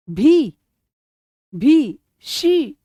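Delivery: भी, भी, शी said slowly, each syllable drawn out; drawn-out भी and शी to hear where the stress falls; slow syllable demo of भी and शी; sounding out syllables. Shown as a demonstration. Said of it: "बोल के देखा करो भी".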